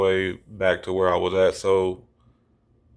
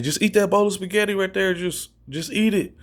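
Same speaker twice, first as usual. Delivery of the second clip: high-pitched imitation of a friend; mock egging on; 10 semitones higher than usual. Friend urging the high storyteller to eat, mock egging on. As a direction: egg him on in a higher voice.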